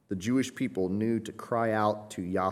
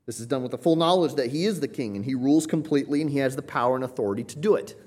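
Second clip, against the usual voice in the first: usual speaking voice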